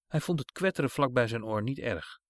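ordinary delivery

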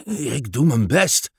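slow, lightly panting father; a tired rower puffing out his words. Acted. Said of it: "zegt vader hijgend". (vader, licht hijgend en traag)

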